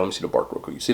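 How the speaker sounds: hushed aside while inspecting food; whispering. Real, not acted. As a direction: drop to a whisper. Looking closely at the chicken.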